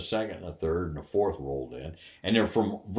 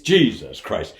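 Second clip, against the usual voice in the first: astonished exclaim; 9 dB louder than usual. Retold or imitated. Mark realizes the prank.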